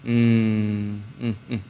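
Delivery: low, drawn-out "mm" then short soft ones; disapproving hum. Acted. Inner voice of God disapproving.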